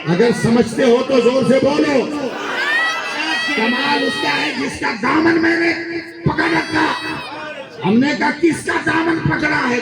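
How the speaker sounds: high shouted preacher cry; impassioned preaching. Real, not acted. Preacher repeating the punchline for the gathering, high shout. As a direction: cry it out to the crowd. Preaching at full force, rousing crowd.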